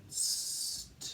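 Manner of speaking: STRING sounded out as separate sounds, st, r, i, ng; phonics-style sounding out of STRING. Shown as a demonstration. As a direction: segment STRING sound by sound with gaps. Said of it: "we can build the word".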